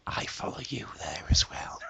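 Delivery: low, breathy creepy whisper. Pretend. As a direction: whisper it like a stalker. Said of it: "so I sound really creepy".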